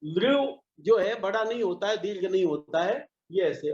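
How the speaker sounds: ordinary talk, nothing marked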